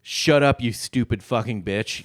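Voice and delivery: mock furious yell; angry shout. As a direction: bark it like a fed-up husband. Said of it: "It just says".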